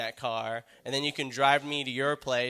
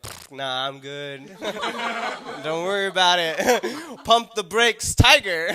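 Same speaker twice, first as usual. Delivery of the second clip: higher, louder, brushing it off with laughs; mock breezy woman; 5 semitones higher, 7 dB louder, slower than usual. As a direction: wave it off, hammed up. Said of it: "She was like".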